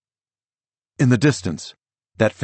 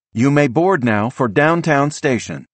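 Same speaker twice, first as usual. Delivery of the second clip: high, louder automated announcement; computerized announcer voice; 7 semitones higher, 4 dB louder than usual. A narrator's reading. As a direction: deliver like a station's recorded voice. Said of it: "that computerized female voice says".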